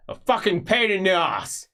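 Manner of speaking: high, loud grumble; grumpy old magister. Acted. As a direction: complain loudly about the boy. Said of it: "he says".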